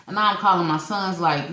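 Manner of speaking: usual speaking voice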